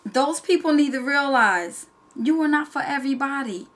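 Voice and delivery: usual speaking voice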